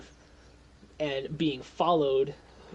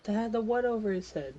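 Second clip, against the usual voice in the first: higher, quicker questioning character voice; quick puzzled question, pitched up; Molly; 8 semitones higher, faster than usual. Acted. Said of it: "Molly is just like".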